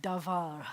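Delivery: davar, said dah-VAHR with a rolled final r; Hebrew word. Shown as a demonstration. Teacher introducing a new word to the class, careful demo. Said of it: "This word is".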